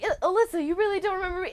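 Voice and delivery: lightly voiced scam texter acting hurt; imitating scam texter. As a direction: play the needy stranger texting, high and wounded. Quoting the scam bot.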